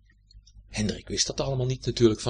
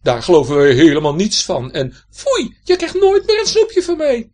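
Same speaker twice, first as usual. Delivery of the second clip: loud, indignant cry, higher on the second half; scolding shout; 8 semitones higher, 13 dB louder than usual. Acted. The neighbours scold Hendrik loudly, indignant. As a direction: shout down a naughty boy. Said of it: "riepen die meneer en mevrouw Van Dalen".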